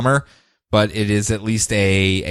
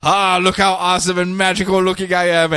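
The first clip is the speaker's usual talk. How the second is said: pitched-up preening boast; mock self-admiring; boastful Kent; 7 semitones higher than usual. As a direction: show off like a vain hero. Kent showing off costume.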